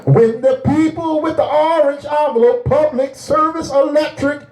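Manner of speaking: high, driving pulpit delivery; full-force preaching. Acted. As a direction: preach it hard with stretched key words.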